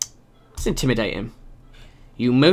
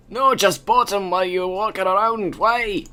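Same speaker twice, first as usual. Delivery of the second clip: bodyguard voice; 5 semitones higher than usual. Acted. Voicing the bodyguard.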